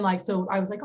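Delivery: normal talking voice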